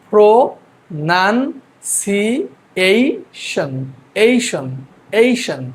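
প্রো, নান, সি, এইশন as separate beats with pauses; syllable-by-syllable pronunciation. Shown as a demonstration. Teacher has students watch the pronunciation, প্রো নান সি এইশন syllabified. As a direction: break it into isolated syllables, repeating এইশন. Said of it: "উচ্চারণটা দেখেন".

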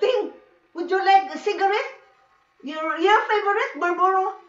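lightly voiced friend tempting with a treat. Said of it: "he's a devil, you know".

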